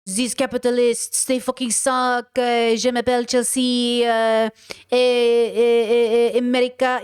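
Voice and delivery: slow, drawled French accent, mocking; a lightly hammed-up French accent; mock French protester. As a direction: play a jaded Parisian leftist. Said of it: "the French people that are like".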